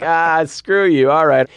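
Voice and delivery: mock grumpy Keanu. Keanu brushing off the director, high mock grumble. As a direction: grumble it in a squeaky joke voice. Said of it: "I was like".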